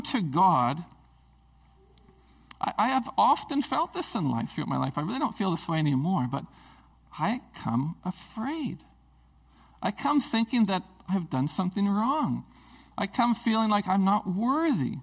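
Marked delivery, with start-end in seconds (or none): none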